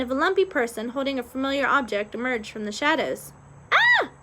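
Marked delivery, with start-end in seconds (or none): 3.7-4.1 high, loud startled scream; short high shriek. A narrator's reading. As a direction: scream in sudden fright, pitched way up. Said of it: "screamed Andre".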